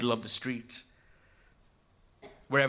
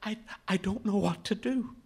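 high, faltering plea; anxious boy at a loss, hoarse; 7 semitones higher than usual. A narrator's reading. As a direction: stammer it helplessly, pitched high. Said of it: "He's hoarse".

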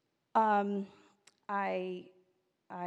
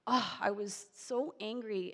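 usual speaking voice

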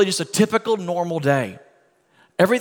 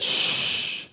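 long whispery shh; calm the panic with one drawn-out shush; imitated hushing. Jesus answering the disciples who woke him, hushing. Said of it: "Jesus said".

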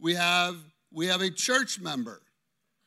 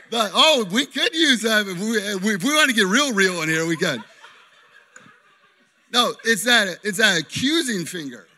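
louder, laughing through words; 9 dB louder than usual. Real, not acted. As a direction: keep laughing while you talk. Laughing at volunteer's finger.

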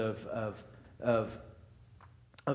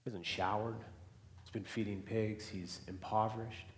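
quiet reflection; 3 semitones lower, 5 dB quieter than usual. Acted. Preacher describing the son's shame, softly. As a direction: let each line sink in softly.

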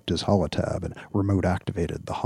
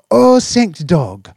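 high loud slow relieved cry; relieved exclamation; 11 semitones higher, 12 dB louder, slower than usual. Acted. Owner seeing his cat appear, relieved exclamation. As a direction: gush with relief. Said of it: "then exclaimed".

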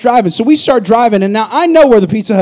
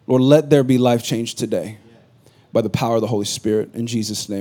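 hushed, reverent prayer voice; 8 semitones lower, 4 dB quieter than usual. Acted. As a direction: pray softly to the congregation. Pastor leads a prayer.

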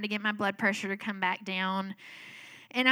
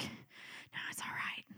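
barely voiced, under the breath; hushed self-reassurance; 17 dB quieter, slower than usual. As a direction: murmur it to reassure yourself. Breathy self-reassurance in retold scene.

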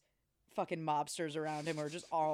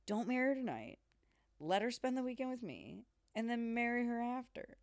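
higher, smooth persuasive pitch; smooth proposition; 6 semitones higher than usual. Retold or imitated. Corman asking Nick for a weekend with his fiancee. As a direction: sell the indecent offer lightly.

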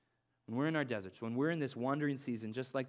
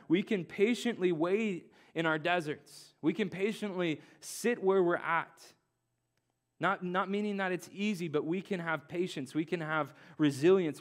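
louder, higher preaching build; rising preaching; 4 semitones higher, 3 dB louder than usual. Acted. Pastor urges the congregation to hold on, preaching. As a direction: stir them toward hope.

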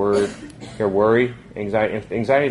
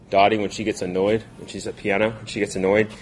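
usual speaking voice

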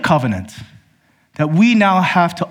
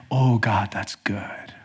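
softer, then a breathless whisper of wonder; awed whisper; 6 semitones lower, 7 dB quieter, much slower than usual. Retold or imitated. Believer reading the Bible, moved by God's law, whispering in awe. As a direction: let it fall away into an awed whisper.

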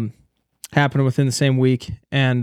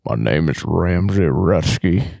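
slow and much lower, a mock booming bass; 9 semitones lower, slower than usual. Teasing the friend about his bass-heavy mic, slow and much lower. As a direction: ham up a deep radio voice to tease him. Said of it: "and his is".

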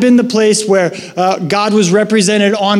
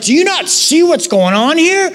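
high, pleading frustration; fed-up cry to God; exasperated plea; 8 semitones higher than usual. Retold or imitated. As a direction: ask God like you can't believe it. Imitating a frustrated prayer to God.

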